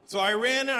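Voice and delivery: normal talking voice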